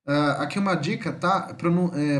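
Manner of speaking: ordinary talk, nothing marked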